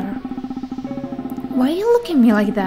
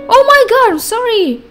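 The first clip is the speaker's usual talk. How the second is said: high loud panicked apology; shocked; 10 semitones higher, 7 dB louder than usual. Real, not acted. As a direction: blurt it out in horror.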